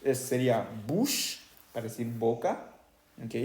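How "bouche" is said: "bouche" drawn out as boosh; slow "bouche" with a full oo. Shown as a demonstration. Teacher shows the OU sound, long oo. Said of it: "este ya el sonido U".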